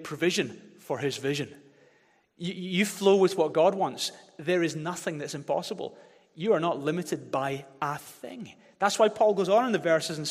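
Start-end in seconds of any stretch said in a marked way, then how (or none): none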